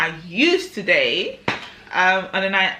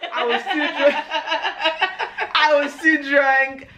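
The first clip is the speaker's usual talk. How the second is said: laughing; 6 semitones higher, slower than usual. Real, not acted. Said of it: "repeated, slower, laughing".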